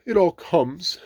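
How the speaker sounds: high, loud cross bear. A narrator's reading. Pooh blaming the small front door, cross. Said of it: "said Pooh crossly".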